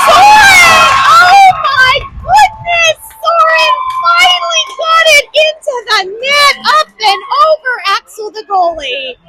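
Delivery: high, loud, joyful shout of the goal; thrilled play-by-play scream; excited goal call. Real, not acted. Goal scored, parent calling play.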